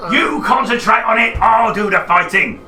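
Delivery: high, loud laughing shout; laughing monster shout. Acted. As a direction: bellow it through laughter.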